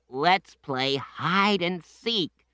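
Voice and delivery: put-on high, slow child voice; children. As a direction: sound like kids begging to play. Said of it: "said the children".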